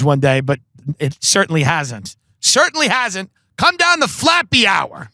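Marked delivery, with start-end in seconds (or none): 2.4-5.1 high-pitched, stretched-out comic announcement; mock calling out. Comic repeats himself plugging Flappy Hour, mock calling out. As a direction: call it out higher, hammed-up.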